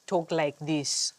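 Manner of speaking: slow, put-on African accent; badly faked African accent, slowed down; attempted African accent. Shown as a demonstration. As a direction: try and fail at an African accent. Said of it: "when I'm trying to sound African".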